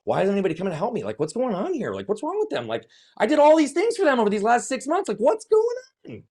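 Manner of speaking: rising into an exasperated cry; resentful outburst. Retold or imitated. Lonely student venting at absent friends, high and frustrated. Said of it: "I'm like".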